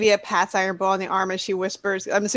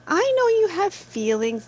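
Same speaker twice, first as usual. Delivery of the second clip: placating; 10 semitones higher than usual. Acted. Soothing a teased friend in character.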